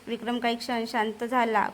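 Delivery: ordinary delivery, nothing marked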